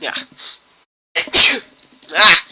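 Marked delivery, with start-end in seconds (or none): 1.1-1.6 sneeze. Real, not acted. Sneeze, bless you follows.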